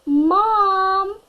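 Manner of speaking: high, loud call for mom; a kid calling out loud; yelling for mom. A narrator's reading. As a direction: shout for mom across the room. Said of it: "I yelled".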